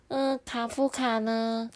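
put-on high, slow child voice; put-on small-child voice; little sister. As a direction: ask as a puzzled little girl, drawn out.

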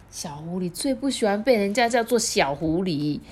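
plain reading voice

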